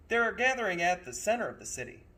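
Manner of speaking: brisk conductor. Acted. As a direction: rush it like an eager guide. Conductor explains.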